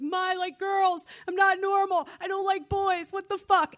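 put-on teen yelling confession at mom; frustrated outburst. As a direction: blurt it out in exasperation.

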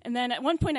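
ordinary delivery

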